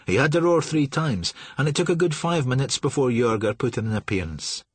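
ordinary delivery

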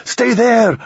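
building high shout. Acted. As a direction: yell it over the shoulder. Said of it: "Ramon shouted".

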